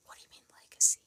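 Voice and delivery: puzzled whisper. Real, not acted. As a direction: ask it under your breath. Puzzled by a viewer's comment.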